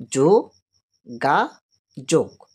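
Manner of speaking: যো-গা-যোগ split into three slow beats, যো then গা then যোগ; syllable by syllable. Shown as a demonstration. Teacher showing students the three syllables, slowly split. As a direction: say যো-গা-যোগ very slowly, a clear break after each syllable. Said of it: "তিনটে ঝোঁক পাচ্ছি".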